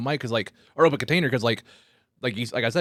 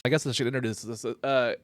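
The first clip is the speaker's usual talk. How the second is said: ordinary talk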